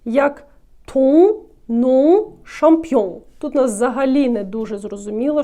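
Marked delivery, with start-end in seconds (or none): 0.9-3.2 ton, nom, champion loud, with the nasal vowel held; strong nasal ending. Shown as a demonstration. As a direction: drive ton, nom, champion through the nose, no final consonant. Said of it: "дуже сильна носова вимова".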